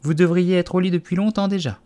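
high, anxious mother canary; high-pitched fretful bird; trembling voice. A narrator's reading. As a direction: urge the children away nervously. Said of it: "d'une voix tremblante".

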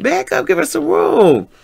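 high-pitched retold appeal; telling him off. Bystander defending a pretty lady, telling him off. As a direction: pitch it way up, urging him back. Telling man to give room.

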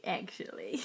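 ordinary talk, nothing marked

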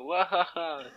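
hammed-up villain laugh; evil laugh. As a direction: wahaha like a cartoon baddie. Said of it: "faire peur avec un rire machiavélique".

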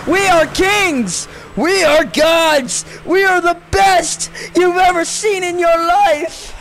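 high, slow, shouted boast; mock-triumphant proclamation. Gamer boasting after finishing twelfth, mock-triumphant shout. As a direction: proclaim it grandly like a hammed-up victory chant.

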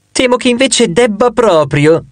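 high, drawn-out lightly gleeful retort. A narrator's reading. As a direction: retort with smug delight, pitched well up. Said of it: "tutta contenta".

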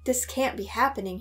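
usual speaking voice

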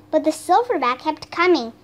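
ordinary delivery, nothing marked

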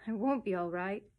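put-on child's wail rising to a loud cry. A narrator's reading. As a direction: build into a tearful protest. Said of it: "wailed Barbara to her pillow".

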